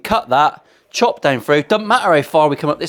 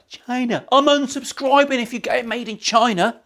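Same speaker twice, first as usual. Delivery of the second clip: mock outraged viewer; 9 semitones higher than usual. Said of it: "I need to get 'em made in China".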